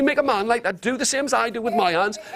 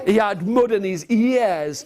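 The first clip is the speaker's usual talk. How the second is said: ordinary talk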